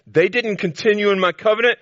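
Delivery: high and loud, covenant ringing out; preached verse. Acted. Preacher thundering the verse at the congregation. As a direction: proclaim "They didn't continue in my covenant" high and loud.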